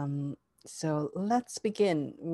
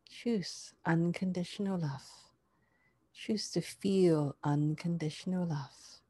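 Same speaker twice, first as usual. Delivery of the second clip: guided meditation; 3 dB quieter than usual. Acted.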